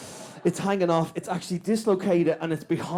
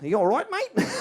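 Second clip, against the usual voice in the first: mock Australian surfer, higher pitch; pitched-up Australian mate; 7 semitones higher than usual. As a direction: imitate a casual Australian accent. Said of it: "he just thought I was Australian".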